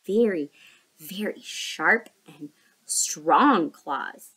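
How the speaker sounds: snappy clicking crab. Acted. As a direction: drawl it like a crab. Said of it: "Kai was scared too".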